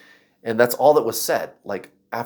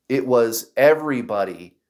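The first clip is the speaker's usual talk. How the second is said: usual speaking voice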